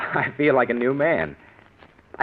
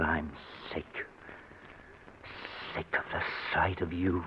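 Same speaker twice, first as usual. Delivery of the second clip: low, softer, venomous line; cold contempt; 7 semitones lower, 14 dB quieter than usual. Acted. Husband finally tells mother-in-law his contempt, low and cold. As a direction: let the hatred out quietly.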